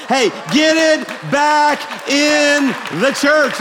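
high, slow, loud call to the room; rallying shout. Real, not acted. Pastor calling the congregation to praise with a loud drawn-out shout. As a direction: fire the church up, drawn out.

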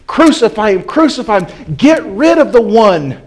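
loud, high crowd shout of Crucify him. Retold or imitated. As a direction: roar it like an angry mob. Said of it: "it got louder and louder".

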